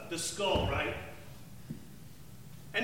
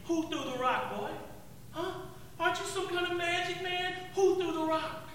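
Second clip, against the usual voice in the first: sneering soldier, pitched higher; a mocking centurion taunting his prisoner; 9 semitones higher than usual.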